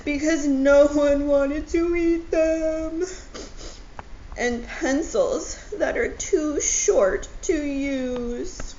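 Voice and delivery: high, loud crying wail; hammed-up wailing. Owl sobs over sad things, loud mock wail. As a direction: wail the list out through sobs. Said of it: "he cried".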